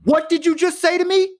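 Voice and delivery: high-pitched indignant challenge; sudden outrage. Retold or imitated. Teller confronts the customer who swore at her, indignant. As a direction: re-enact snapping from sorry into affronted disbelief. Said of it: "without a hesitation, I went from".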